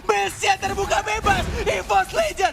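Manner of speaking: high, loud play-by-play climax; excited shouting. Real, not acted. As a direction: build the hype to the win. Caster calls game-winning push.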